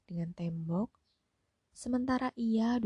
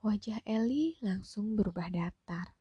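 usual speaking voice

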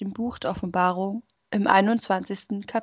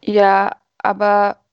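wary; 6 dB louder, slower than usual. Acted. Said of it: "argwöhnte die Traurigkeit".

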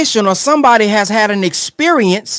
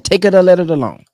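ordinary talk

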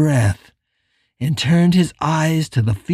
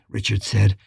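plain reading voice

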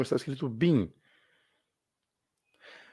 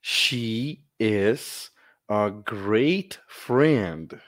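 very slow, careful English; slow teacher demo; 2 semitones lower, much slower than usual. Shown as a demonstration. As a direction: spell out every word. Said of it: "lesse comigo". (leitura bem lenta)

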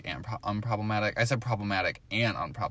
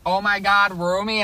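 excited squeal; 10 semitones higher, 7 dB louder than usual. Retold or imitated. Friend Megan's excited comment quoted.

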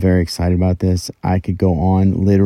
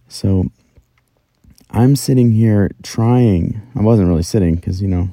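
ordinary talk, nothing marked

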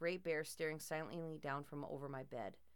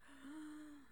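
frightened gasp; 10 semitones higher, 11 dB quieter than usual. A narrator's reading. Hears step downstairs.

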